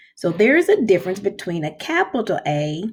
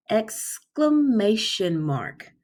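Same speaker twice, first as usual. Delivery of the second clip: slow, drawn-out Exclamation mark; said slowly; 3 semitones higher, much slower than usual. Shown as a demonstration. Teacher leading kindergarteners in saying it slowly. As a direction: stretch out each syllable for the kids. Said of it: "try to say that with me".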